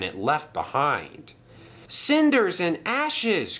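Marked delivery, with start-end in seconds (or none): 1.9-3.6 high, drawn-out exclamation; alarmed cry. A narrator's reading. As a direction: cry out in shock at the wreckage. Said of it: "cried Percy".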